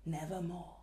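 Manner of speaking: the raven's low reply. Acted. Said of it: "Quoth the raven".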